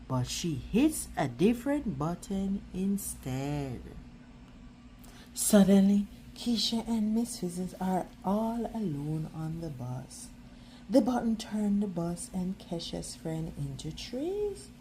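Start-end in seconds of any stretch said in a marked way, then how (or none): none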